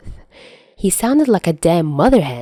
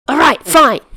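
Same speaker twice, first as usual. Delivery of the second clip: higher, louder firm concession; declared; 8 semitones higher, 5 dB louder than usual. Acted. Chuya accepting Dazai's movie pick, loud challenging declaration. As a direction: announce it like a challenge. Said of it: "Chuya declared".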